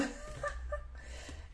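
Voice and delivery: breathy, voiceless burst of laughter. Real, not acted. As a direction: laugh under your breath. Laughs at her own find. (rire soufflé)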